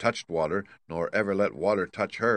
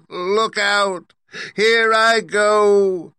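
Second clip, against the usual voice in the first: high, loud wail, slowing and stretched at the end; melting witch; 10 semitones higher, 7 dB louder, slower than usual. A narrator's reading. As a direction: wail your last words as the dying witch, ending on a long shriek. Melting witch's dying speech.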